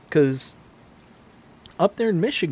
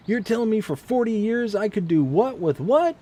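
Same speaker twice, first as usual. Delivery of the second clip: put-on a light Southern good old boy twang, pitched higher; 8 semitones higher than usual. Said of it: "he's got the thick Southern twang to him".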